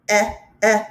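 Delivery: Eh, eh — a short clipped e vowel repeated; short e sound. Shown as a demonstration. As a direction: isolate the short e. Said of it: "short E sound".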